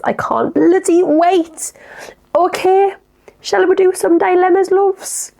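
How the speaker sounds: high, bubbly presenter voice; high chirpy "shall we do some dilemmas, loves?"; excited intro. Real, not acted. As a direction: launch the segment with a high sing-song lift.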